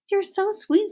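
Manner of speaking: high, sweet princess cooing. Acted. Princess to the tiny mouse, high sweet coo. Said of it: "said the princess to Despereaux".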